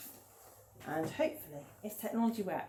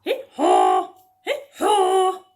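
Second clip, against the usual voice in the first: put-on loud braying hee haw for the children; donkey noise; 9 semitones higher, 13 dB louder, much slower than usual. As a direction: bray like a donkey, louder. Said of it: "join in with me making the animal noises".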